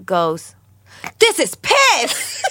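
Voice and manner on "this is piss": very high, slow disgusted cry; outraged squeal. Retold or imitated. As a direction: shriek it in shock and disgust, laughing. Friend tasting piss, outraged shout.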